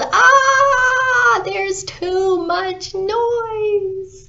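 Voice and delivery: very high, drawn-out scream of frustration; long anguished holler, stretched slow; exasperated yell. Acted. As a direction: yell it as if tearing your hair out. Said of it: "clutched his hair and yelled".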